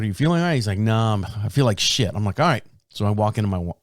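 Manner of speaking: normal talking voice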